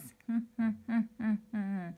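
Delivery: villainous pea cackling with glee; a gleeful villain's laugh; evil cackle. Acted. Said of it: "The evil pea".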